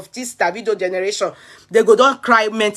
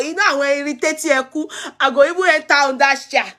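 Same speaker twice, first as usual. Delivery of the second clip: ordinary talk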